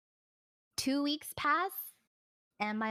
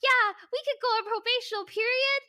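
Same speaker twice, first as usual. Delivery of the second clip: much higher, louder overeager yes-voice; mock eager agreement; 14 semitones higher, 8 dB louder than usual. Girlfriend accepting a probation period, high overeager voice. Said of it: "I was like".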